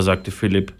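ordinary delivery

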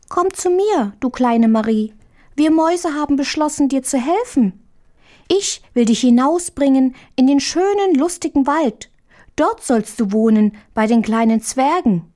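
high-pitched, clearly spoken mouse voice; squeaky little mouse. A narrator's reading. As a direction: pipe it up high like a small mouse, every word clear. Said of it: "sprach mit quiekender Stimme, aber ganz deutlich".

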